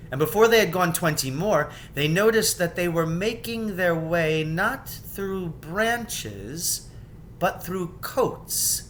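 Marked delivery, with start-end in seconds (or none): none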